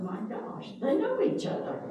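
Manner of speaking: high, amazed exclamation; astonished. Retold or imitated. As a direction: pitch jumps way up in surprise. Retold shock at discovery.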